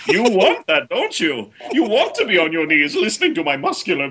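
high, theatrical seductive villain; hammed-up seducer. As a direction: overplay the seducer, teasing.

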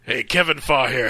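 ordinary delivery

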